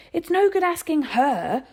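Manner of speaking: high, loud, slow shout. A narrator's reading. Boy shouting at his mother about Dinah, loud. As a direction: bellow the accusation. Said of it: "Lloyd shouted".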